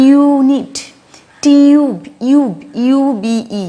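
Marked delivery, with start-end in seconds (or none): 0.1-2.5 "ইউনিট, টিউব" high and loud, yoo drawn out; U as "you". Shown as a demonstration. Teacher repeating examples for learners, long loud yoo in "ইউনিট, টিউব". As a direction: exaggerate the yoo in "ইউনিট, টিউব", then "ইউব". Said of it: "ইউ এর মতোই উচ্চারণ করছি".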